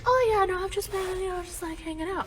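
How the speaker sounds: high, small voice feigning nonchalance; fake-casual dad. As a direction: play it casual, pitched high and softening. Dad hides being upset.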